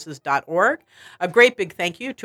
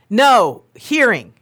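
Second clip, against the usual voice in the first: louder and higher each time; increasingly loud repeating; 6 semitones higher, 3 dB louder than usual. Retold or imitated. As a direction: raise the voice, repeating slowly. Nurse repeating into phone, rising.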